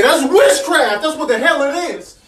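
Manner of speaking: ordinary talk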